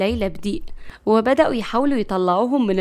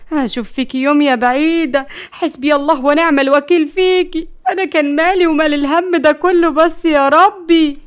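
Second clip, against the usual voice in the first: raised, loud wailing curse; angry lament; 5 semitones higher, 5 dB louder than usual. Acted. Grandmother blames Layla for the disaster, bitterly lamenting. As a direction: curse her, then wail to heaven.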